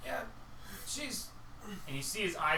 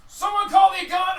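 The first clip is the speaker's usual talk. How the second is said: high, loud yelled alarm; high loud alarm cry; panicked shout; 13 semitones higher, 12 dB louder than usual. Acted. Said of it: "they're like".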